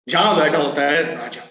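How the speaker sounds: plain reading voice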